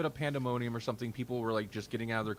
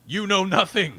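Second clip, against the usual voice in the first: loud haughty put-down, pitched up; mock pretentious shout; 8 semitones higher, 13 dB louder than usual. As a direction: bark it down at the humans like a snob. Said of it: "They're like".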